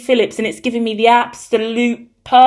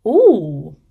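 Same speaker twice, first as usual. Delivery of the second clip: ordinary talk, nothing marked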